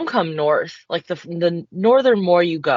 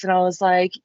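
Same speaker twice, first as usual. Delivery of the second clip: ordinary talk, nothing marked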